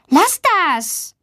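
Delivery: mildly cross, high-pitched telling-off; scolding. A narrator's reading. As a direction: tell off a teasing classmate. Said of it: "Luisa schimpft".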